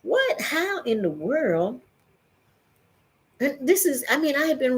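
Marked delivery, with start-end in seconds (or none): 0.0-1.8 rising shock, breaking high on what; dawning horror, voice jumping up; horrified realization. Retold or imitated. Retelling the moment the relapse hit, horrified. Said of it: "but it was like".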